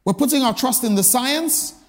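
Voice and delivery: usual speaking voice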